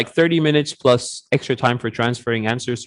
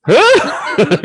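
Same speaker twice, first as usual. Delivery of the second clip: laughing mock confusion; 12 semitones higher, 4 dB louder than usual. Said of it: "It's like".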